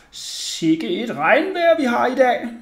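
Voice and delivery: high, slow, drawn-out lady's chat; smiling neighbour lady. A narrator's reading. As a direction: make smiling small talk, high and stretched out. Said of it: "smilede og sagde".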